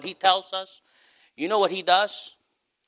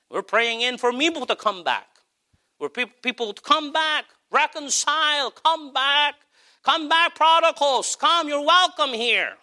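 impassioned preaching; 10 semitones higher than usual. Acted. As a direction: call the prodigals home from the pulpit. Preacher calls prodigals back.

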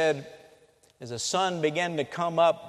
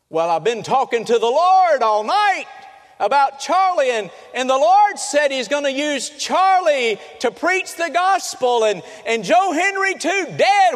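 much higher, loud, cries out in desperate disbelief; frantic disbelieving shout; 11 semitones higher, 7 dB louder than usual. Retold or imitated. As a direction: scream it at the husband who says she's lost her son. Grieving mother confronts husband.

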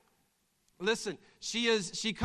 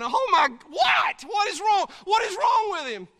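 alarmed mother; 12 dB louder than usual. Acted. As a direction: jump up from praying in alarm. Mother alarmed, voiced in skit.

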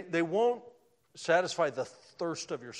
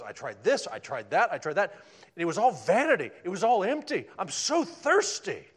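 loud, climbing, slowing to a cry at the end; weary Solomon; 3 semitones higher, 9 dB louder than usual. Acted. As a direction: voice King Solomon crying out, worn out. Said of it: "He says".

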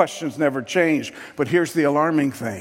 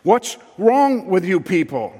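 louder, higher, insistent rebuke; mean it this time, press harder; retold earnest demand, louder than the first; serious; 5 semitones higher, 5 dB louder than usual. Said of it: "I'm serious".

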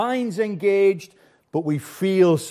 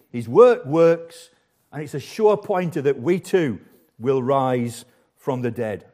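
usual speaking voice

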